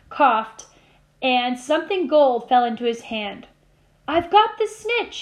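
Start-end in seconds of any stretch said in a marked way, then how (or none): 4.1-5.2 high, slow triumphant shout. A narrator's reading. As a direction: lift it up like a cheer. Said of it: "he shouted".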